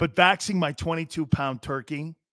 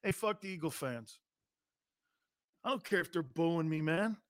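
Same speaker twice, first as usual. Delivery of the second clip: softer, dismissive mock quarterback; mock Baker in Philly; 10 dB quieter than usual. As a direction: brush off the Eagles fans lazily. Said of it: "Baker Mayfield in Philly doing this".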